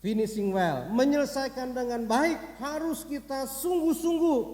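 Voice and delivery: loud, rising exhortation; preacher building in pitch and force; emphatic preaching. Real, not acted. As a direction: drive the point home to the congregation.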